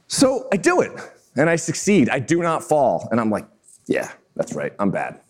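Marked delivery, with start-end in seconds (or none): 3.9-5.2 low, soft self-satisfied swagger; mock cool-guy boast, dropped way down; cocky. Storyteller imitating himself after succeeding, low and cocky. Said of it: "kinda cocky".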